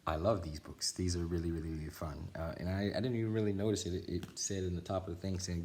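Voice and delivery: usual speaking voice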